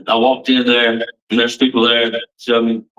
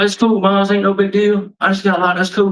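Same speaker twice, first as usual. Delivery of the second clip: high-pitched, mildly acted brush-off; breezy reassurance; 7 semitones higher than usual. Locked-up son telling his mom it's no big deal, breezy. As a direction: play down the trouble to a parent.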